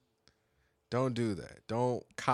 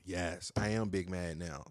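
ordinary delivery

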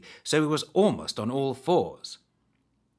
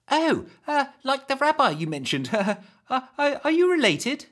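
put-on high curious girl; 14 semitones higher, 4 dB louder than usual.

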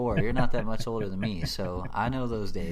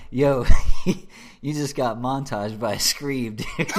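laughing; 8 dB louder than usual. Retold or imitated. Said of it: "this dude's like".

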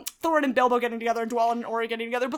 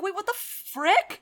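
baffled; 8 semitones higher, slower than usual. Retold or imitated. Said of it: "and I was like".

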